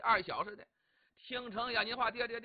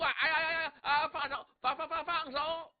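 high, yelping stammer; pained pleading; 6 semitones higher, 3 dB louder than usual. A narrator's reading. Li in Xu's iron grip, high pained stammering. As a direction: cry out in pain, begging for release. Squeezed wrist, begging to let go.

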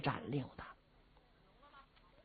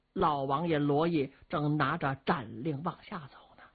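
plain reading voice

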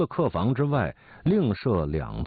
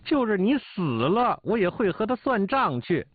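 higher, grim vow of revenge; through clenched teeth; 12 semitones higher than usual. A narrator's reading. Mother vows revenge for her injured son, teeth clenched. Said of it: "咬牙切齿地说".

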